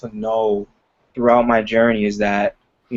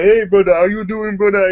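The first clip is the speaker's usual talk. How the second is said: higher-pitched mock African greeting; African accent; 7 semitones higher, 4 dB louder than usual. Comedian saying it to their face in fun, mock African accent. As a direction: hail him playfully in a put-on African accent. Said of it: "I would say it to their face".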